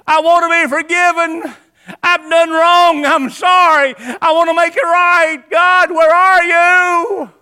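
high, loud pleading shout; anguished calling out, voice strained high; desperate cry to God. Acted. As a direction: cry out for God like a lost sinner. Preacher acts desperate sinner crying out.

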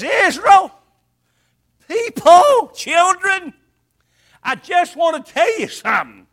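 booming shout of God calling his people; God thundering a promise to his people; God proclaiming. Acted. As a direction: call out to Israel like God himself, loud and high. Preacher voicing God to Israel.

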